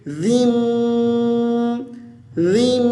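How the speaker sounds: जिम as "dhimm", tongue between teeth, m held; joined to meem. Shown as a demonstration. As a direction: hum long on the end of जिम. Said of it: "मीम के साथ मिलाएंगे".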